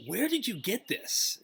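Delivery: high, slow incredulous question; astonished demand. Acted. Shopkeeper demands where ruby came from.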